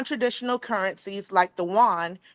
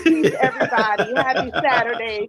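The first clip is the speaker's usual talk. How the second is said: laughing; 4 dB louder than usual. Real, not acted. Greeting while laughing off hiccups.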